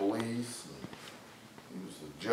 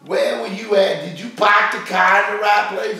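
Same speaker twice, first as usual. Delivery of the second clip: put-on loud, high southern-accent nagging; mock southern accent; 12 semitones higher, 15 dB louder than usual. Husband imitates his wife's angry southern accent. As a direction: scold like an angry southern wife. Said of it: "they go".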